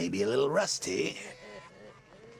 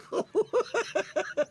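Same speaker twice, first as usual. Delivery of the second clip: high-pitched mocking cackle. Joker cackling at the Penguin. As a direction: cackle like a gleeful madman.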